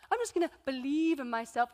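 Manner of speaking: sing-song mocking tone; mock her naive optimism; sarcastic mockery. Skeptic mocking the speaker, sarcastic sing-song. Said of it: "some of you are sitting here thinking".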